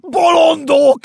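high, very loud, anguished yell; exasperated scream, climbing in pitch; desperate bellow. A narrator's reading. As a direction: howl it at a heedless crowd. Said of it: "üvöltötte Benyámin".

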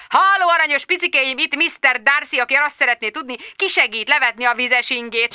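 high, fast, camp send-up of Darcy; mock Darcy. Tom mocking Darcy on the tape, in a high camp voice. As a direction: ham up a simpering gentleman.

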